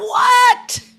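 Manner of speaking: high, loud astonished what; shocked they got it so fast; amazed what. Real, not acted. Host stunned by the instant song guess, high loud disbelief.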